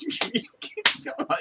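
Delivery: shrill cackling laugh; loud high laugh; high burst of laughter. Real, not acted. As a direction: crack up. Laughing after quote.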